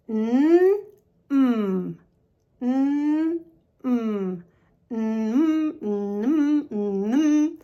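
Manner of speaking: "N" held as a hum, then closed-lip "mm"; alternating N and M. Shown as a demonstration. Teacher drilling nasal sounds with learners, alternating hums. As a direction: switch drawn-out hums between "n" and "mm". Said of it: "Let's alternate between the N and".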